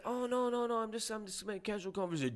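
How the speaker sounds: high, hurried backpedaling; quick, high-pitched retold appeasement; mock placating. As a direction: imitate someone nervously talking his way out. [name] retold placating the angry man.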